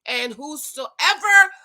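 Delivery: slow, loud, preaching recitation; John 3:16 proclaimed, slow and loud; scripture recitation. Real, not acted. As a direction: preach the verse slowly. Quoting John 3:16.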